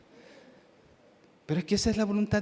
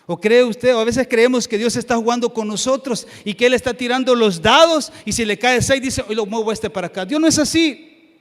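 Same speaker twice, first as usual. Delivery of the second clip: loud, raised-pitch sermon, swelling to a shout; full-force preaching; 6 semitones higher, 11 dB louder than usual. Real, not acted. Preacher insisting God does not play dice, full-force preaching. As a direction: hammer the point home to the room.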